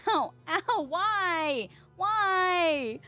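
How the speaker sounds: high, drawn-out wailing protest; mock pitiful whine. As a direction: plead like a hammed-up innocent victim. Mock victim of thrown items.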